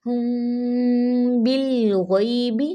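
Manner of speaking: हुम बिल गैबी slow, मीम hidden in the nose, बिल rising; slow recitation; nasal ikhfa. Shown as a demonstration. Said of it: "आवाज़ मीम की नाक में ले जाएं".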